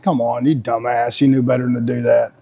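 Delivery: low, mildly self-scolding mutter to himself; imitated self-reproach, pitched low. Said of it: "down on myself".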